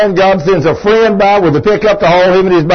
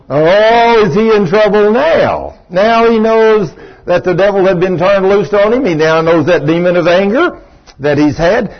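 ordinary talk, nothing marked

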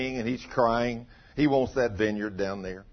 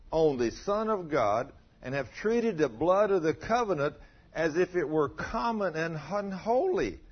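higher, weighty reading; solemn scripture reading; 6 semitones higher than usual. Acted. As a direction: proclaim the warning gravely.

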